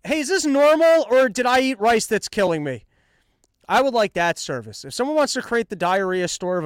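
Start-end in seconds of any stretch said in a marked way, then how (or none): 0.0-2.8 frantic mock worry. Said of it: "go like".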